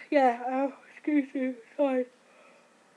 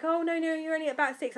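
higher mock midwife voice; 7 semitones higher, 5 dB louder than usual. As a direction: wave her off like a breezy midwife. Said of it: "they were like".

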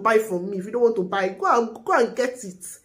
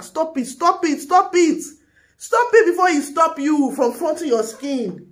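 higher, louder, heated command to viewers; angry ranting plea; 6 semitones higher, 4 dB louder than usual. Real, not acted. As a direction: hammer it home like a fed-up big sister. Ranting vlogger urging viewers.

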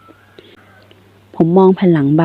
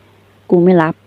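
slow and clear; much slower than usual. A narrator's reading. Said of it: "ผมพูดช้าๆ ชัดๆ".

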